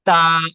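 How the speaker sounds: ता struck loudly with the tongue tip at the upper teeth roots; clear ता. Shown as a demonstration. Teacher loudly models ता for the class to repeat. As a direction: hit ता firmly from the tongue tip. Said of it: "निकालने की कोशिश करें".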